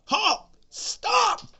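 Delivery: high loud mock guard yell; mock shout. Security guard yelling at the robbers, a mock shout. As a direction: bark the command.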